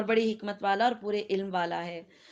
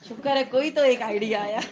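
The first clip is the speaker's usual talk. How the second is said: louder, quick, breaking into laughter; amused relief; 6 dB louder, faster than usual. Real, not acted. Teacher teasing students for finally offering an idea, amused. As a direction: say it fast through rising laughter.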